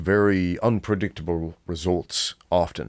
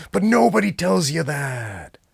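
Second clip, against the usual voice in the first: high-pitched exasperated complaint; 9 semitones higher than usual. Real, not acted. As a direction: protest it high, sliding down at the end. Exasperated complaint, then repeated plainly.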